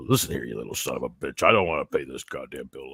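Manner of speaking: growly deep phone complaint, played for laughs; mock complaint yell. Shown as a demonstration. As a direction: chew them out way down low. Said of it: "yell at 'em like this".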